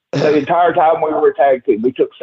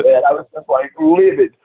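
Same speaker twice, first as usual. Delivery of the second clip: ordinary delivery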